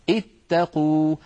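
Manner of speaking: اتقوا drawn out slowly, as ittaqu; slow demonstration. Shown as a demonstration. Teacher shows اتقوا slowly on screen. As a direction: stretch every sound of اتقوا. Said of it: "انظروا إلى هذا الفعل".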